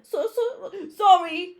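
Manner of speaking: high, loud, crying apology; sobbing. A narrator's reading. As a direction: sob it out. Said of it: "sobbed Hagrid".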